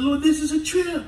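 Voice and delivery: high, wide-eyed disbelief to the Lord; mock amazed prayer. Pastor marveling to the Lord at his healing, high and amazed. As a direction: squeal it up high in wonder. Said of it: "I said".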